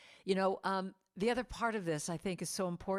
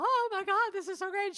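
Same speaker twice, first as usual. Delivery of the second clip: much higher, louder gushing joy; 13 semitones higher, 6 dB louder than usual. Retold or imitated. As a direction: squeal it like a thrilled winner. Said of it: "she's all".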